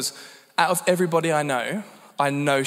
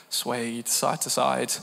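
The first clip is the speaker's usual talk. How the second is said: normal talking voice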